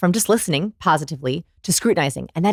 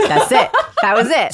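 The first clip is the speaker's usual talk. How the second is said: high, louder laughing words; laughing approval; 7 semitones higher, 5 dB louder than usual. Real, not acted. Guest reacting to the host's attempt, laughing. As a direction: confirm it through real laughter.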